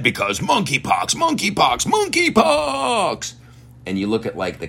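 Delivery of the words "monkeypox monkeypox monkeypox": high, drawn-out mock shout; mock alarm. Host mocking monkeypox panic on the map, hammed-up wailing. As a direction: wail it like a hammed-up horror trailer. Mock scary announcement of disease.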